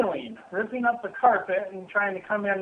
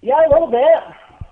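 loud, raised voice to the phone; yelling across the room; 6 semitones higher, 8 dB louder than usual. Real, not acted. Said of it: "Can you not yell across the room?".

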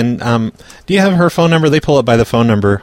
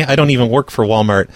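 ordinary talk

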